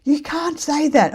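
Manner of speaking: high, shocked scolding from a friend. Retold or imitated. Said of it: "Mel looks at me and goes".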